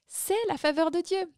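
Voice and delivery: retold delighted cry, pitched high; exclamation émerveillée. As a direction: tell your companion in happy amazement. (exclamation ravie, voix haute)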